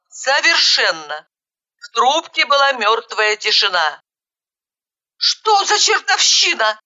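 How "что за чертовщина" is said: high, exasperated curse; cursing. A narrator's reading. Caller finding the phone suddenly dead, cursing. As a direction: swear in a high-pitched burst of frustration. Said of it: "выругалась я".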